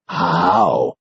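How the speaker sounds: dazed wonder; lightly voiced old man. A narrator's reading. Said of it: "He blinks his watery eyes".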